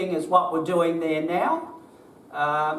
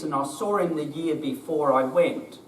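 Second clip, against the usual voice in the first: normal talking voice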